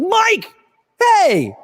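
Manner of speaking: high bright hey to a passing player; excited greeting. Retold or imitated. Reporter greeting a player unexpectedly, high excited call. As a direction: hail him like an old friend.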